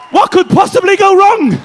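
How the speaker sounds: high, loud shout; over-the-top acted confidence; triumphant pitch. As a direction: crow it to the whole room. Brain's triumphant final sales pitch.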